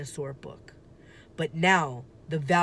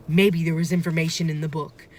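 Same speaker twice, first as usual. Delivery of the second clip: usual speaking voice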